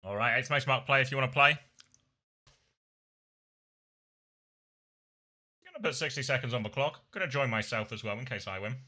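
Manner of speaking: talking while chewing; words round a bite of meat; mouth full. Real, not acted. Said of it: "I'll stop talking with my mouth full".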